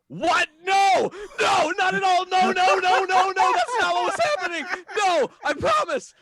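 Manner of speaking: high, loud frantic denial; panicked shout. Retold or imitated. Son frantically denies to dad that the song is about mom, shouting high and panicked. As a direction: yell it in a panic, talking over him.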